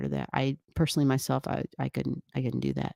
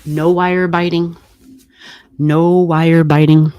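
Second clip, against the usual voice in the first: loud, drawn-out command to a pet; slow, loud correction, stretching the words; real warning to an animal; firm pet scolding; 13 dB louder, slower than usual. Real, not acted. Scolding pet for biting wire.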